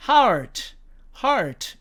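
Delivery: HEART with the r sounded; American heart variant. Shown as a demonstration. Teacher showing the other American way, HEART with r. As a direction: keep a clear r inside HEART. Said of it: "미국식으로 발음하면".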